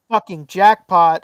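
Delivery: loud, slow, savoured "Fucking jackpot"; overjoyed at the cheap price, drawn out; gleeful jackpot. Retold or imitated. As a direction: relish the win out loud. Thrilled at cheap cutouts.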